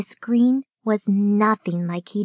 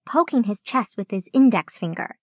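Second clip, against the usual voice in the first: ordinary delivery, nothing marked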